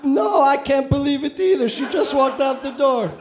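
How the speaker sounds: high, loud put-on Middle Eastern accent; a botched Middle Eastern accent; bad Middle Eastern accent. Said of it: "I can't even remotely do a Middle Eastern accent".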